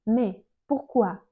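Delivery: ordinary talk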